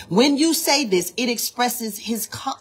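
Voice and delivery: ordinary delivery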